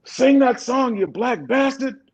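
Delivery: high-pitched heckler's holler; imitated yell. Someone heckling during an intimate moment, imitated yell. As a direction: holler it from the crowd, drawing out the last words. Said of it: "Someone yelled out".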